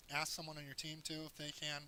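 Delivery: ordinary delivery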